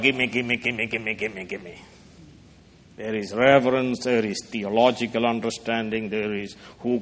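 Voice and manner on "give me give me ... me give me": mock greedy begging. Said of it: "not going into the presence of God".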